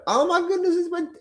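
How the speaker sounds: panicked trader, hammed-up squeal; mock panic. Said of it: "it's like, don't do that".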